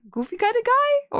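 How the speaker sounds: very high, silly cartoon voice; goofy guy. Shown as a demonstration. Reader auditioning a voice for the caller, goofy and high. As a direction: pitch it way up and goofy. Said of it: "is he goofy guy".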